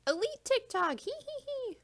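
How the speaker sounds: high, squeaky mocking girly voice; TikTok girl imitation. Mocking an elite TikTok girl, high mock-girly voice. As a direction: mimic her in a high-pitched singsong with a tittering giggle. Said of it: "She's that girl who's like".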